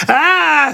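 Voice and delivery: high, drawn-out "aah"; sustained high-pitched wail held on one note; snake's cry. A narrator's reading. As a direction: let out a long high cry. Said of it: "said the snake".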